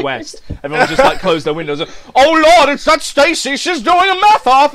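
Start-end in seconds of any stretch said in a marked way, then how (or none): 2.1-4.7 loud, high townsfolk panic; mock alarm. Townsfolk closing windows as the duel starts, mock alarm. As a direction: cry out in hammed-up Old West dread.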